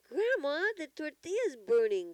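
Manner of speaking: high, loud alarmed call; urgent high-pitched yell; crying out. A narrator's reading. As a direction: cry out a warning as the child. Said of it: "cried Lolly".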